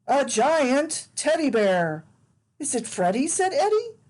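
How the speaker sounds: put-on lively read-aloud for small children; animated picture-book narration; storybook reading. A narrator's reading. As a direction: read it like bedtime story time.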